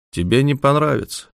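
plain reading voice

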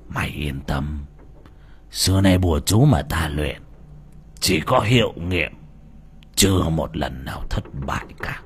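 put-on low whispery sinister old man; creepy old sorcerer. A narrator's reading. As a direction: breathe it like a creepy witch doctor. Voices old sorcerer Tapon.

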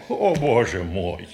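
low, soft groan of despair; crushed dismay. Acted. Man whose secret is out, crushed low lament. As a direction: let it sink down, weakly.

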